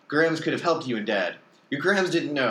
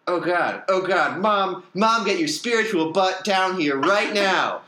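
loud, high furious yell upward; screaming at the ceiling; 8 semitones higher, 6 dB louder, slower than usual. A narrator's reading. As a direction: scream for Mom to come down, seething. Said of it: "Piper screamed to the ceiling".

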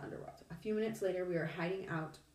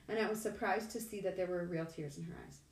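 ordinary delivery, nothing marked

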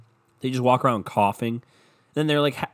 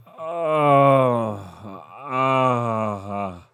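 mock ox groan. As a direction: groan like a straining ox, hammed up. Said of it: "I sound like I'm doing sex with".

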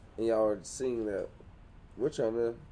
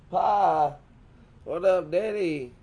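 high, loud country twang; 6 semitones higher, 3 dB louder than usual. Acted. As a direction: lay on a rural Southern twang. Acted country store customer with twang.

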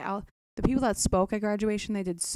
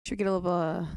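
normal talking voice

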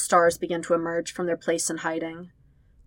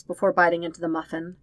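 ordinary delivery

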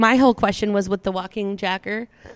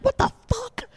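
very high-pitched bird voice; swearing cockatiel. Retold or imitated. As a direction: squeal it like a startled pet bird. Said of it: "the cockatiel was like".

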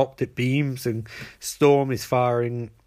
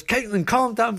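high, urgent retold plea; hammed-up exasperated order; mock exasperation; 9 semitones higher than usual. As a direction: imitate pulling someone off a fight. Pulling her off in fight.

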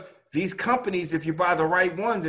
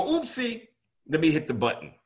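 mock oopsie. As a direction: poke fun at a careless viewer who hits the button. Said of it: "I'm not gonna go".

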